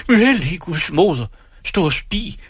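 high, excited cry of wonder; startled cry. Acted. Two women cry out at the sight in the forest, high and amazed. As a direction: gasp it out, pitch leaping up in awe. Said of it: "udstødte et råb".

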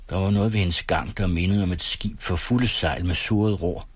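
usual speaking voice

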